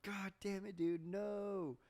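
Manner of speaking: high-pitched mock outcry of dismay; exasperated yell. Retelling his outburst at the boy who tossed the kitten, high yell.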